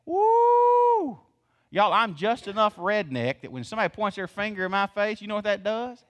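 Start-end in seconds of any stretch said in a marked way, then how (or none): 0.1-1.2 high, loud drawn-out "woo"; heated whoop. Shown as a demonstration. Preacher showing congregation how accusations rile him, high whoop. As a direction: let out a long falsetto whoop.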